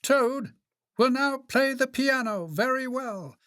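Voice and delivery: high announcer voice; high-pitched stage introduction; strange voice. A narrator's reading. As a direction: announce the act in a high, grand voice. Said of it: "said the strange voice".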